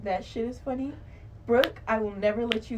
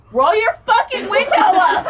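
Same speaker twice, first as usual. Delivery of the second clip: high, loud laughing yell at passing cars; half-laughing imitation of a drunken shout; mock drunk yell; 9 semitones higher, 8 dB louder than usual. Retelling drunk friend yelling at concerned drivers, mock shout. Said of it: "every time somebody would".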